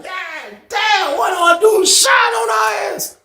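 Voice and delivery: high-pitched shocked shout; shocked yell. Retold or imitated. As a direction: yell it out in disbelief. Retelling his own shocked reaction.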